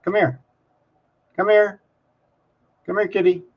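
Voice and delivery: pet-calling voice; calling a cat. Real, not acted. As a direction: call the cat over in a high little voice.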